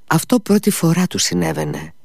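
ordinary delivery

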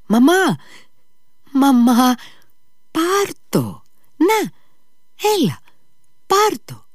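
put-on high, loud child crying out; a sick boy calling out high to his mother; sudden cry. A narrator's reading. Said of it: "φώναξε ξαφνικά ο Ηλιούσα".

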